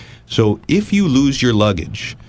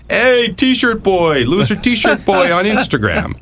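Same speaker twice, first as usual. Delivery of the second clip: high, drawn-out taunting catcall; slow, singsong mock heckling, pitched way up; mocking heckler; 13 semitones higher, slower than usual. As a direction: jeer it like a playground bully. Imitates online hecklers mocking him.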